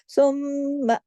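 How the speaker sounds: सुम्मा with a long hummed म्म; big nasal hold. Shown as a demonstration. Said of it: "जैसे ही सुम शुरू हो, उधर ही आपने नून गुन्ने पे चले जाना है". (सुम्मा, बड़े गुन्ने के साथ)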